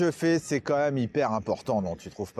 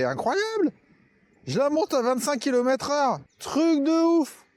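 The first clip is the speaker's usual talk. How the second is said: amazed; 10 semitones higher than usual. Real, not acted. Amazed climbing the hill.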